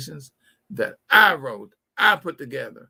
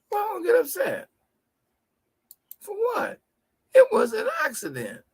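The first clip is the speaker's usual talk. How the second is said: much higher, shouted, a disbelieving question; high incredulous reply. Retold or imitated. To the lady who hit his car, high incredulous disbelief. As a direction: leap up in pitch, amazed anyone would be upset.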